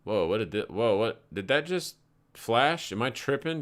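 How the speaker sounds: usual speaking voice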